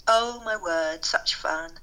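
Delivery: ordinary talk, nothing marked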